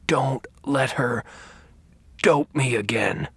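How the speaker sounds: hoarse, slow, forceful plea. Acted. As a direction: push the words out with strength. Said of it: "he said in a hoarse voice with surprising strength".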